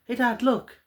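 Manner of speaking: put-on high little girl's voice; little daughter. Daughter calls her dad to look, in a high little girl's voice. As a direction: pipe up like a small girl. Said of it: "the father's daughter goes like".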